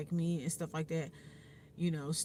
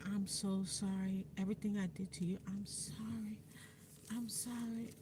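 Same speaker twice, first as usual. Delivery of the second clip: soft apology; 8 dB quieter than usual. Retold or imitated. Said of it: "and she was like".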